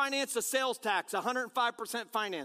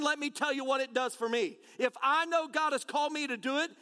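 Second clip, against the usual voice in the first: ordinary delivery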